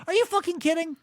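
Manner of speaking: ordinary talk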